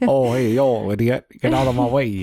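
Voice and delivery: mock Boston accent, punched up; mimicked bad Boston accent. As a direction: parrot a friend's poor Boston accent with gusto. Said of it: "I can't do a Boston accent".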